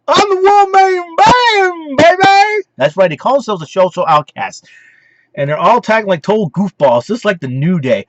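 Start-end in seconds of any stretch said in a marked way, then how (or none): 0.1-2.6 very high, slow wailed catchphrase; hammed-up wrestler yowl; Heath Slater. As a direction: squeal it out, stretched and loud. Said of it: "And Heath Slater".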